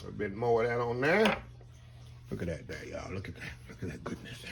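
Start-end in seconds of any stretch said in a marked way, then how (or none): 2.3-4.4 hushed, almost whispered admiration; awed hush. Real, not acted. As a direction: drop to a near whisper over the meat. Admiring the cooked prime rib.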